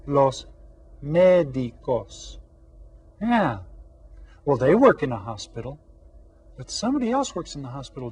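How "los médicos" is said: "médicos" stretched slowly for the class to repeat; slow model. Shown as a demonstration. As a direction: say "médicos" slowly for everyone to echo. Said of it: "Repitan todos".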